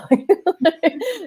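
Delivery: high breathy laugh at herself; self-mocking laugh. Real, not acted. Therapist laughing at her own backstory, rueful laugh.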